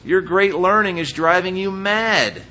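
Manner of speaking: high, loud, slow outburst at Paul. Retold or imitated. Festus shouting at Paul in court, loud outburst. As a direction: cry out that he's lost his mind. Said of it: "Festus said in a loud voice".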